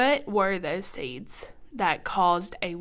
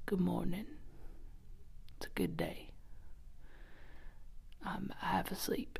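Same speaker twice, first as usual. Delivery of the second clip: Morgan Freeman morning voice; 12 dB quieter than usual. Retold or imitated. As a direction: sound like you just rolled out of bed. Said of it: "Morgan Freeman in the morning".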